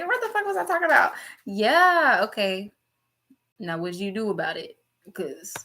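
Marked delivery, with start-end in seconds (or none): 0.0-1.1 high and fast, lost the thread; flustered self-quote. Retold or imitated. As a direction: rattle it off like you forgot everything. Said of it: "I'm like".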